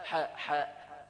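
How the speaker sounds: حاء, حاء drawn out very slowly; letter demo. Shown as a demonstration. Teacher spells out the ح sound slowly for students. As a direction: stretch حاء, حاء slowly so the breathy ح is clear. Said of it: "الأصل إنه ينطق الحاء".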